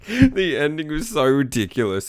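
can barely get it out for laughing; cracking up. Real, not acted. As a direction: laugh your way into it. Cracks up at ridiculous ending.

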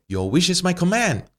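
high, lilting fairy godmother. Acted. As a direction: grant the wish sweetly, up high. Fairy godmother grants the wish.

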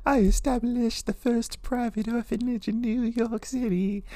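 very high, slow falsetto voice for the wife; voicing his wife. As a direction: draw out the answer in a lofty high voice. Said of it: "And she's like".

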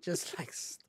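whispered echo of the joke; unvoiced whispered repeat. Real, not acted. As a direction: breathe it out as a whisper. Echoing co-host's joke.

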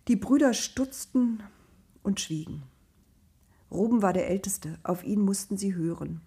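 usual speaking voice

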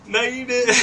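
loud, high-pitched disbelief; incredulous retort. Retold or imitated. Teller reacting to the police scanner report, incredulous. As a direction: fire back in high, loud protest.

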